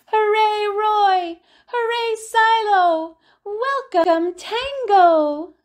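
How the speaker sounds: high, loud cheering voice; children cheering. Acted. Zoo children cheering the penguin family, high and loud. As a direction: cheer them on like kids at the zoo. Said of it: "they cheered".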